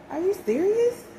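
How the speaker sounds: high, disbelieving question; high delighted disbelief; amazed. Retold or imitated. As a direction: jump up in amazement. Amazed reply to clerk.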